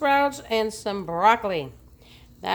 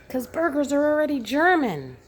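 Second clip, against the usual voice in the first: higher, louder joking aside; mock exasperated; 9 semitones higher, 4 dB louder, faster than usual. Vlogger joking that burgers are German, mock exasperated. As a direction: toss off the joke in a higher voice.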